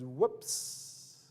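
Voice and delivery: whispered, sheepish little whoops; mock whoops. Preacher voicing the son's sheepish whoops. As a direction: breathe it like a caught kid.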